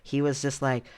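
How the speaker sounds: normal talking voice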